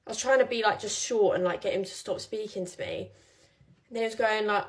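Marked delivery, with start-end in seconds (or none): none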